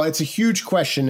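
ordinary delivery

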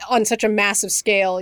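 ordinary talk

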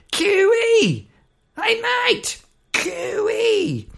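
very high-pitched countryside holler; Australian cooee call. Acted. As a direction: call out to a distant mate. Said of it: "they do, they do this".

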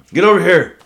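loud high barked order; mock drill instructor shout. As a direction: yell it like a drill instructor calling a recruit. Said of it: "And so".

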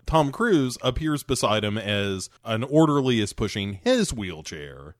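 ordinary delivery

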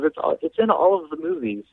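very high mimicking voice; mock a friend squeakily insisting; high-pitched, cartoonish insistence; imitating insistent friend. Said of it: "And she says".

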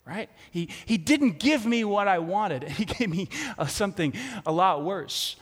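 ordinary delivery

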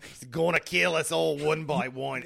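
loud hammed-up New York line; mock New York accent. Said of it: "her New York accent becomes".

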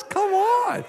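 high-pitched, drawn-out hype call; playful crowd-rousing cry, way up high; hyped-up. Real, not acted. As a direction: whoop it up for the bassist. Hyping the bassist to the crowd.

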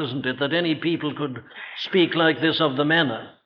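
ordinary delivery

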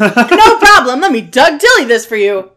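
mock-obliging shout; high, booming, sarcastically loud reply; exaggerated loud voice. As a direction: belt it out, overdoing the projection. Told to speak up, overdoes it.

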